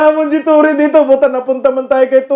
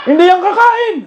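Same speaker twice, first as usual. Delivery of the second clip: mocking; 7 semitones higher than usual. Real, not acted. Mocking politicians again.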